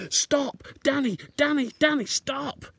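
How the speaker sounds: loud, high warning shout; father shouting a warning to his son; urgent shout. Acted. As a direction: yell at him to stop before he falls. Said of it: "he said".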